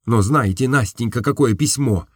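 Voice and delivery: ordinary delivery, nothing marked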